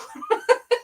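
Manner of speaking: high, airy pained chuckle; breathy laugh. Real, not acted. Laughing after biting tongue.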